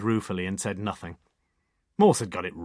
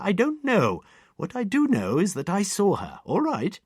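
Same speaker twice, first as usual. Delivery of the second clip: put-on much higher, louder, primly precise elderly lady; prim old lady; 9 semitones higher, 7 dB louder than usual. A narrator's reading. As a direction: tick off the inspector like a matron. Octogenarian gives her testimony.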